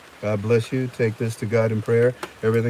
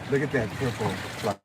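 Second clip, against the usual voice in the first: ordinary talk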